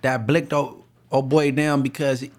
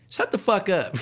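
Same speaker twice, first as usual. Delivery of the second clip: mama snapping at him; 5 semitones higher than usual. Retold or imitated. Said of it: "my mama talks to me crazy".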